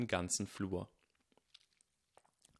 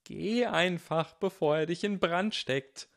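slow, high, loud warning cry; urgent scream; 8 semitones higher, 5 dB louder, slower than usual. A narrator's reading. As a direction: yell at him to get out now. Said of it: "schrien Slytherin, Hufflepuff und Gryffindor".

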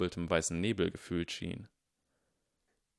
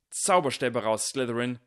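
loud, raised rallying cry; calling out; 4 semitones higher, 7 dB louder than usual. A narrator's reading. Slytherins answer the Hufflepuff threat, calling out. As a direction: shout the call to arms. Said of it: "riefen etwa fünf verschiedene Slytherins".

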